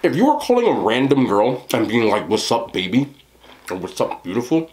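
chewing between words; talking with food in mouth. Real, not acted. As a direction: mouth partly full. Seen 3: spoonful taken at -1; mouth moving, food in mouth during words.

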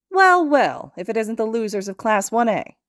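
loud, high, smug taunt; obnoxious. A narrator's reading. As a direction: gloat over the losers. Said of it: "An obnoxious voice interrupts".

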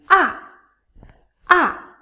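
"Ah" short, loud, falling from high; falling fourth tone. Shown as a demonstration. Teacher demonstrates the fourth tone, powerful falling "ah". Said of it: "is a falling tone".

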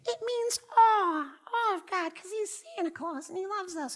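high saccharine parody of the teaching; mocking false teacher. Preacher mocking the popular teaching, high and sugary. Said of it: "another false teaching".

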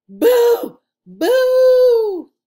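loud, high shouted boo; playful monster boo. A narrator's reading. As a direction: yell boo to scare a friend. Kids scaring with masks.

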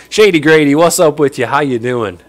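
normal talking voice

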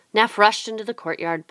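usual speaking voice

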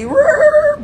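a high, loud growl like a dog; mock growl. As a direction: growl at them, hammed-up. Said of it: "I get over there and be".

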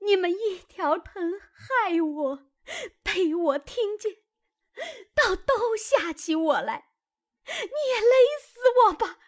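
high, tearful yell that climbs to a loud shriek; wife hurling herself at her husband, wailing hysterically; crying, screaming wife. Acted. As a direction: throw herself at him wailing and shouting. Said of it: "叫道".

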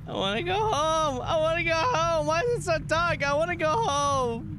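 high, pleading scared whine; scared, high-pitched pleading; frightened whining. Real, not acted. Scared player begging to leave game.

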